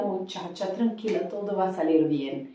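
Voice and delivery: very soft, low reassurance; soothing. Acted. Teacher softly soothes a student in Spanish. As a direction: hush them gently, all will be fine.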